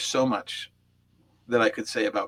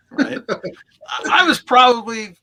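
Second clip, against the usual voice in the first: laughing; 12 semitones higher, 10 dB louder, slower than usual. Real, not acted. Laughing at his own story.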